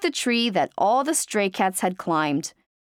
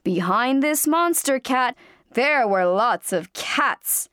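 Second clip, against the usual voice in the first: plain reading voice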